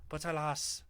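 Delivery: mock Western European accent; hammed-up Western European accent. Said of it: "Western European accent or something".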